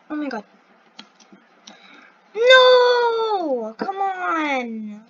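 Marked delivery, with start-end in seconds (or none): 2.4-5.0 high, loud "no" easing into a drawn-out plea; frustrated wail. Real, not acted. Player failing at the same jumps, frustrated wail. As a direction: wail at the game, then sag. Fails again.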